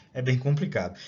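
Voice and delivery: normal talking voice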